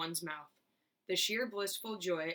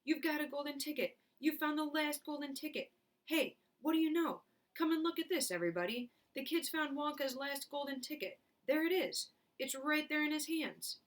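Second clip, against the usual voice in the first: overexcited; 8 semitones higher than usual. Acted. Shopkeeper beside himself.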